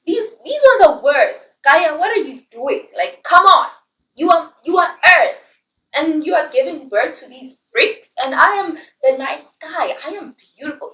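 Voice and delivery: high, loud indignant complaint; mock outraged sky god, pitched high; vain Uranus. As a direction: whine like a vain god scolding his wife. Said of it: "she's like".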